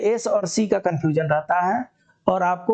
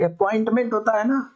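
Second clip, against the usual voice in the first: normal talking voice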